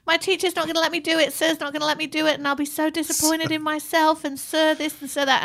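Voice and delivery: mock sobbing daughter protest; whiny upset daughter. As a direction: mimic a teary kid complaining it's unfair. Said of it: "She was crying and she's like".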